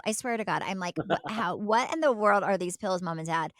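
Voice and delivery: usual speaking voice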